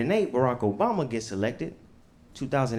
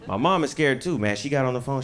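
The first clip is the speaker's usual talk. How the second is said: ordinary talk, nothing marked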